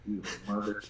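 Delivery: grumbled half insult; muttered insult. Retold or imitated. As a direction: mutter it under your breath. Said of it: "I'm like".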